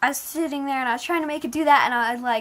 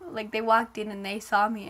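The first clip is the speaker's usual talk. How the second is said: ordinary talk, nothing marked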